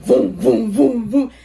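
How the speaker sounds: loud, punchy rumbling booms; mimicked earthquake thuds; imitated quake booms. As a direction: voice the room jolting, boom by boom.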